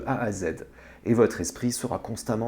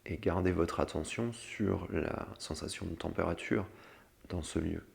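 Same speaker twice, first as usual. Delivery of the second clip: guided meditation; 4 semitones lower, 8 dB quieter than usual. Acted. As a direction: lead them gently into calm. (voix douce de méditation guidée)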